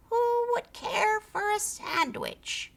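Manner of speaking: high, slow, soft call from far away; faint distant creature call. A narrator's reading. Creature calls to the scouts from afar. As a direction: sing it out like a far-off lure.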